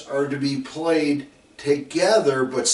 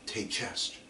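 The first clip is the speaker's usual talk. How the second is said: cue close in the ear; 3 semitones lower, 10 dB quieter than usual. Retold or imitated. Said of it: "close enough in your ear".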